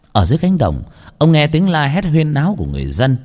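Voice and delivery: ordinary delivery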